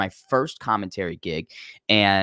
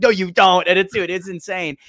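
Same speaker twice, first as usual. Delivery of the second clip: loud, higher mock snap back; angry retort; 8 semitones higher, 7 dB louder than usual. Fighter dismissing matchmaker's wrestling past, mock angry retort. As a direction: imitate a fighter cutting him off, high and loud.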